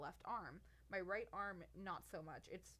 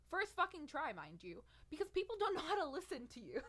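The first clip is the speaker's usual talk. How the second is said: louder and higher, fed-up rant; exasperated outburst; 9 semitones higher, 5 dB louder than usual. Real, not acted. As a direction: let the frustration burst out. Venting about medical staff.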